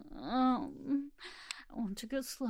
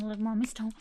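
usual speaking voice

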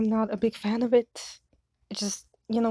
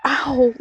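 pained ow; 3 semitones higher, 7 dB louder than usual. Acted. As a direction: cry out at the second slap. Slapped again.

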